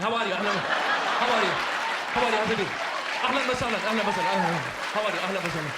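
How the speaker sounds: rapid Arabic-accented greetings. Shown as a demonstration. Mocking how Arabs talk, fast Arabic accent. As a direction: rattle the greetings off quickly.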